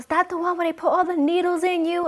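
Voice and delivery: high, loud imitation of a panicked mom; hammed-up maternal panic; freaking-out mom. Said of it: "she started freaking out".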